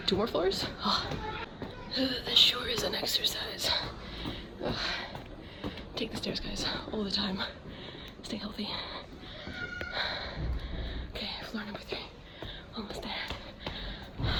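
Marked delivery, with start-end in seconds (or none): 1.9-3.7 breathy whisper, slightly winded; hushed comment while climbing. Real, not acted. Whispering on the stairs. 8.2-14.3 whispering. Real, not acted. Whispering in the shop.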